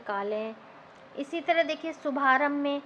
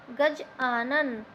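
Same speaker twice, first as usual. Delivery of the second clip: slow split demo; 3 semitones lower, much slower than usual. Shown as a demonstration. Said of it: "आ और बड़ा मिला के क्या बना?".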